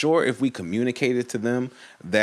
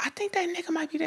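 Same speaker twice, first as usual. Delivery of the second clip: high, quick, hushed worried voice; hushed worry; 7 dB quieter, faster than usual. Retold or imitated. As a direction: retell it softly, squeaky-high and rushed. Retold hushed worry in the car.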